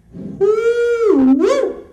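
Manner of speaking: high, loud whooping cheer; excited whoops. Acted. The speaker celebrating finding his passion, loud high whoops.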